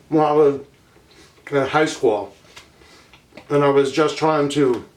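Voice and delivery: talking through a mouthful of pancake; words muffled around a bite of food; mouth full. Real, not acted. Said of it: "sorry, I don't mean to talk with my mouth full".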